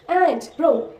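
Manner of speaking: normal talking voice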